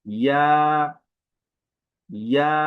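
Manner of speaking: ইয়া with the vowel held long and rising; ইয়া with a long drawn vowel; long pull. Shown as a demonstration. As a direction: stretch the আ of ইয়া. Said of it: "লম্বা টান হবে".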